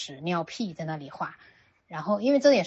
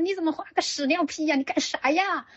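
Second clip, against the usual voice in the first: high, teasing fake astonishment; mock surprise; 10 semitones higher, 4 dB louder than usual. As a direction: pretend to be shocked to make him laugh. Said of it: "我就故意说". (故意夸张的惊讶语气)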